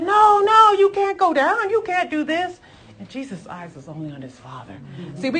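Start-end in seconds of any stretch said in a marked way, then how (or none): 0.0-2.6 high, loud pleading objection; urgent high-pitched remonstration; alarmed protest. Acted. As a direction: cry out to stop a friend going. Acting out Peter's protest to Jesus.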